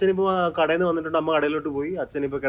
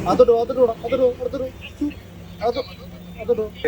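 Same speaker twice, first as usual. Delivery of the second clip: urging the cat; 8 semitones higher, faster than usual. Real, not acted.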